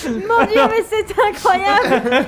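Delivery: high, loud amazed laughing cry. Acted. Amazed cook reacts.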